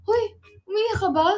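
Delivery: high, loud retold question; startled question. Asking her friend if she is crying, startled. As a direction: call it out high, checking on her. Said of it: "Tapos sabi ko".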